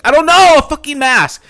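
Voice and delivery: high, loud exasperated yell; mad outburst. Retold or imitated. Terrified woman snapping at her boyfriend, a high, loud yell. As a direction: snap back, sick of explaining. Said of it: "she gets mad".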